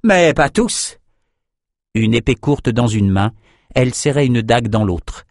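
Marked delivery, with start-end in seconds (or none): 0.0-1.0 high teasing yell; cocky cry, pitched up; shouted taunt. A narrator's reading. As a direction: tease an ally over the din. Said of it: "cria Thorn à Nathan".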